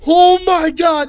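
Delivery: loud, high, drawn-out exclamation; shocked cry. Real, not acted. Streamer hearing disturbing screams in a video, shocked cry. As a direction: cry out slowly in real shock.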